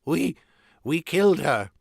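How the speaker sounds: high, halting confession; falter, then force the confession out; nearly weeping. A narrator's reading. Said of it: "nearly weeping now".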